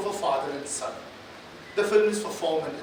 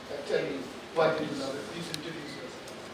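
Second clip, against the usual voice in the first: ordinary talk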